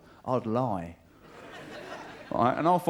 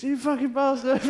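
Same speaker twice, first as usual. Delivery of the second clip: high-pitched blustering abuse; hammed-up thuggish taunt; mock hooligan threat; 10 semitones higher, 4 dB louder than usual. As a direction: hurl it high across a gap you won't cross. Hooligans stopped short, threatening each other.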